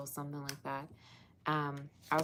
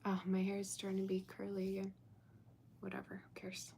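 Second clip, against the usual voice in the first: breathy unvoiced whisper to herself; whispered aside; 3 semitones higher, 6 dB quieter than usual. Real, not acted. Reader whispering to herself about her curly hair, hushed aside. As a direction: whisper it off to the side, half to yourself.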